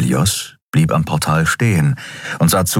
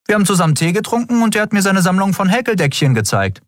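breezy, fast, higher small talk; chatty tone; faster than usual. Acted. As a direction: toss it off casually, like gossip. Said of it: "antwortete Endriel im Plauderton".

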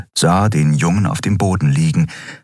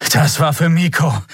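out of breath, higher-pitched angry declaration; Kai; 8 semitones higher than usual. A narrator's reading. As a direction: spit it out, winded after the fight. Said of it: "erklärte Kai schwer atmend". (schwer atmend, wütend, mit erhobener Stimme)

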